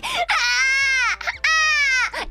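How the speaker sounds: high mock baby cry, two bursts; hammed-up baby scream; newborn wail. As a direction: squeal like a newborn just out of the womb. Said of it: "just immediately like".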